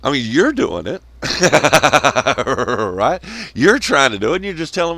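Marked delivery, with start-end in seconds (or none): 1.2-2.9 loud barking laugh mid rant. Real, not acted. Ranting to viewers, breaks into a loud laugh. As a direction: burst out laughing at your own point.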